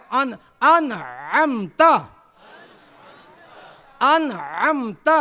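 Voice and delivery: slow انعمت, squeezed ع; throat ain drill. Shown as a demonstration. Teacher repeating for students, throaty ain in انعمت. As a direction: swoop through a drawn-out ain in انعمت. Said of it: "ام میں عین ہے".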